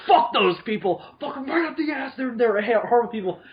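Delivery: put-on angry teenager rant. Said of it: "the angry teenager part of me comes out".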